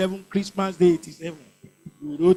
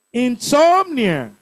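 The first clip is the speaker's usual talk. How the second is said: Insomnia, slow with a high, loud "som"; stress on second syllable; 4 semitones higher, 5 dB louder, slower than usual. Shown as a demonstration. Teacher finding the odd word out, Insomnia stressed on "som". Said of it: "Second syllable".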